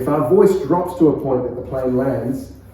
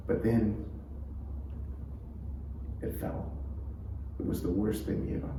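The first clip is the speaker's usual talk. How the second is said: softer, slow and hushed; slow and sad; 3 semitones lower, 13 dB quieter than usual. Shown as a demonstration. Preacher demonstrating a slow, quiet sad moment. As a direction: let the sadness sit in the pauses. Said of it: "So you slow it right down".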